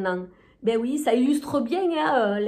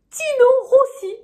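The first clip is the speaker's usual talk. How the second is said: high, bright-eyed shop cashier; 14 semitones higher, 7 dB louder than usual. Retold or imitated. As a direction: play the chirpy cashier up high. Punchline name, voiced high. (voix de caissière aiguë)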